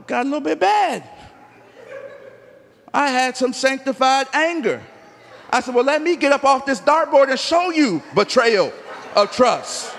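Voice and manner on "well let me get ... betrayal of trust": mock angry retort. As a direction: retell the angry outburst, half-laughing. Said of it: "I was mad".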